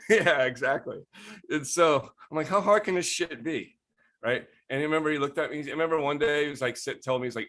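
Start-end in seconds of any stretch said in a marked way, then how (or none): 2.5-3.8 high-pitched scoff; scoffing disbelief. Retold or imitated. Retelling own jealous motivation to grow weed, scoffing. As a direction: make it a cocky, exasperated challenge. Repeats scoff at roommate's success.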